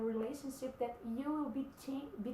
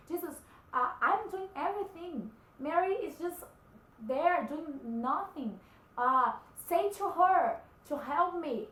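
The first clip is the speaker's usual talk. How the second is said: higher, louder complaining plea, slowing at the end; exasperated Martha; 4 semitones higher, 7 dB louder than usual. Acted. Martha complaining to Jesus about her sister, higher and louder. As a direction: whine to Jesus that you're doing all the work. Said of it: "she approached Jesus and she said".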